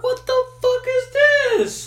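high, loud, slow cry of total confusion; drawn-out squeal of disbelief; baffled outburst. Retold or imitated. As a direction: gape at a strange soda can out loud. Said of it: "you're like".

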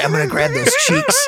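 high mocking impression of a fighter; mock goofy taunt. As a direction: squeak the threat up high like a silly cartoon. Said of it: "He goes, he goes".

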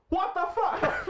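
high, loud South African outburst; South African bro. Acted. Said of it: "If it's like a brew going".

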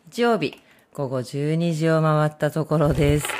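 ordinary talk, nothing marked

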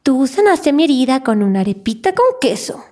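the dubbing actress does her Mirabel line on request; Mirabel's voice. Shown as a demonstration. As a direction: give them Mirabel, sweet and young. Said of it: "alguna frasecita con la voz de Mirabel".

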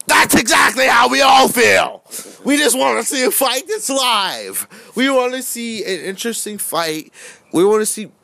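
high, loud fired-up yelling; rally the crowd with a yell; excited shouting. Real, not acted. Hyped rant about wanting fights.

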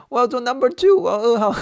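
put-on high, loud cracking kid voice; boy's desperate squeal, voice cracking. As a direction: squeal it so the voice breaks. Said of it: "我还破音".

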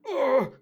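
high, loud mock moan "Oh!"; retold moan. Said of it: "it sounds like".

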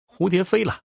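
high, called-out cry; child calling out. A narrator's reading. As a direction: call out into the room for her. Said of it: "于是他大喊着".

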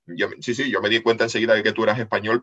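ordinary delivery

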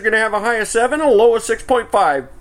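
high-pitched mock forecast; weather forecaster. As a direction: parody a presenter's breezy sign-off. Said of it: "And it's always like".

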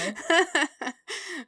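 high burst of embarrassed laughter. Real, not acted. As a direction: laugh it off, flustered. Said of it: "now I'm embarrassed".

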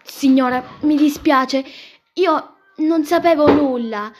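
loud, high, slowing plea; dramatic apology. Acted. Girl apologises to the aunt for not knowing, dramatic. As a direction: apologise emphatically, dragging out the phrases.

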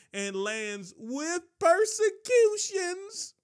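high, slow, ringing pulpit call; drawn-out preacher's cry; climactic preaching. Real, not acted. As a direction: lift the verse to its peak. Climactic scripture read.

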